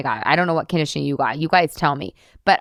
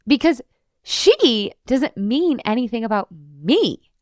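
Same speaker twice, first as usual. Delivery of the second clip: ordinary talk, nothing marked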